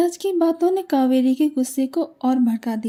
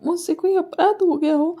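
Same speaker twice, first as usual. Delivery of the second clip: put-on young wife's higher voice, tearfully pleading; crying; 5 semitones higher than usual. A narrator's reading. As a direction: plead through tears, lost and scared. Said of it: "वो रोए जा रही थी।". (रोते हुए गिड़गिड़ाना)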